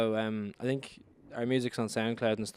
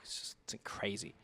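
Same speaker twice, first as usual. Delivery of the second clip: whisper; 13 dB quieter than usual. Real, not acted. Overwhelmed by festival news.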